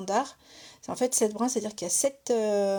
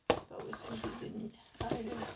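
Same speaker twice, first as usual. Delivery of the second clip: fast whispered mutter while searching; hurried half-whisper to herself; 11 dB quieter, faster than usual. Real, not acted. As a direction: mumble it under your breath, quickly. Searching for her thread.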